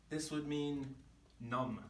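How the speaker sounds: ordinary talk, nothing marked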